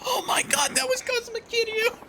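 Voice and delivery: very high, panicked gasp; shocked shriek. Acted. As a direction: squeal in horror at the realization. Thug realizes who it was.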